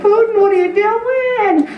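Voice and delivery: high-pitched mock scolding of Putin; exasperated plea. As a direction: scold Putin like a naughty child. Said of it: "I'm like".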